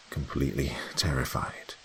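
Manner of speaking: plain reading voice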